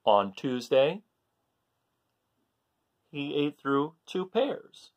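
plain reading voice

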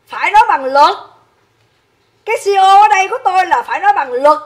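nói bằng luật nhấn mạnh, to và cao giọng; forceful insistence. Real, not acted. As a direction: hammer home "phải nói bằng luật" with rising force. Insisting on speaking by law. (giọng to, dồn dập, nhấn mạnh "nói bằng luật")

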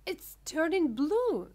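high, loud, drawn-out cry; slow, high storybook scream. A narrator's reading. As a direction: scream it slowly in alarm. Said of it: "screamed Missus Beauregard".

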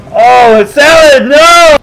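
high slow cry of dismay; dismayed shout. Real, not acted. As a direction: yell it out in dismay. Shouting as companion dies.

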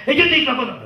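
high, heated customer rant; an upset client shouting over you; mock yell, pitched well up; angry customer yelling. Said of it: "Está gritando, que hasta zapatea".

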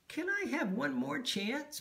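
high, small pleading voice; begging. A narrator's reading. Peep asking the conductor for another try, begging. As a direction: plead for it like a little bird. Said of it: "begged Peep".

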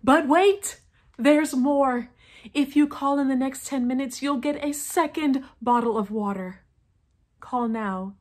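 loud, high-energy sales pitch; hyped infomercial shout. Acted. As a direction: sell it like late-night TV. Classic infomercial upsell.

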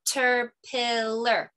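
"Caterpillar" slowly, syllable by syllable, with claps; clapped syllables. Shown as a demonstration. Teacher demonstrating syllable clapping for kids, slow and even. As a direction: clap out "Caterpillar" slowly in four beats. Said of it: "So I'll demonstrate".

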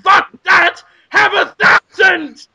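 loud, high slowed shout; eager shout. Pretend. Crowd member outbidding the price, loud eager shout. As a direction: bellow the offer out.

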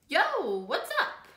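high, punchy street hello; friends' casual greeting. Acted. Friends greet Carmelita in the park, high and lively.

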